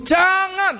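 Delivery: high, emphatic warning cry; urgent prohibition. Real, not acted. Preacher warning the congregation against retreating, urgent prohibition.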